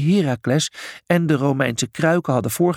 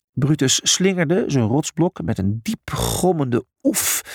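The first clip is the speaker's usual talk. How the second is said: ordinary delivery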